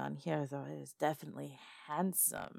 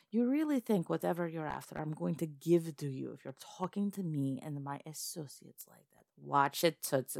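usual speaking voice

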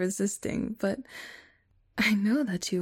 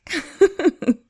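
evil cackle; 8 semitones higher, 11 dB louder than usual. Acted. Evil gloating laugh.

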